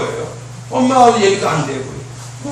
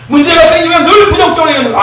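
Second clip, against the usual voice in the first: preaching; 6 semitones higher, 6 dB louder than usual. Real, not acted. Preaching on teens' negativity.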